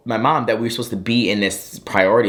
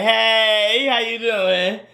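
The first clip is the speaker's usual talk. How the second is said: high, awkward mock-friendly hello; hammed-up apologetic hello; sheepish greeting, acted out; 9 semitones higher, much slower than usual. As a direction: greet him in a high, embarrassed voice. Acts sheepish greeting to stranger.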